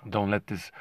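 ordinary talk, nothing marked

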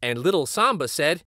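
plain reading voice

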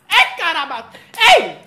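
loud laughing shout; church call-out. Retold or imitated. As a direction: whoop from the pews. Churchgoer calling out during sermon.

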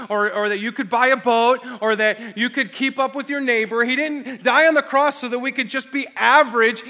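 loud, building sermon proclamation; preaching at full force. Acted. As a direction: hammer each all home to the church. Preaching climax.

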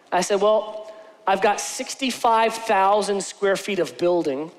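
ordinary talk

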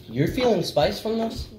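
high disbelieving question; can't believe what you hear; incredulous. Real, not acted. Disbelief at friend's spice.

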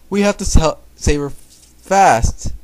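loud, high urging; acted urgent cry; urgent alarm. A narrator's reading. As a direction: urge them on loudly, stretching the last word. Character urging a rescue.

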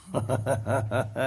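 a short, low chuckle. Real, not acted. Laughs after the punchline.

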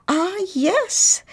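ordinary delivery

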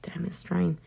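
whispered annoyed aside. Real, not acted. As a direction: mutter it to yourself. Nail polish drying too fast.

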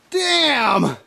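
ordinary talk

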